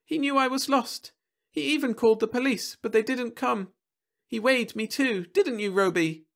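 put-on high, excited child's chatter; a girl rushing out her story; babbling little girl. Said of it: "began babbling at her".

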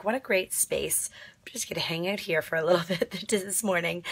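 soft breathy whisper, almost no voice. Real, not acted. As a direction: hush it like a secret hideout. Hiding in cozy space under bed.

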